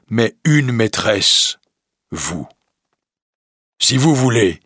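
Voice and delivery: the beast offering to leave, timid and sincere; lightly voiced gentle beast. The beast asking Belle if she finds him ugly, humbly.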